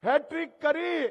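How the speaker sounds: punchy, high political proclamation; rallying call. Real, not acted. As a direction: call it out to a big crowd. Rally speaker rousing the crowd.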